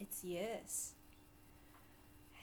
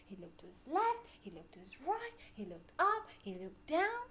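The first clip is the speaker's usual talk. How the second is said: normal talking voice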